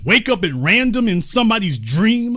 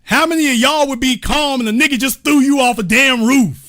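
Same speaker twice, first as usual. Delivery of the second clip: ordinary talk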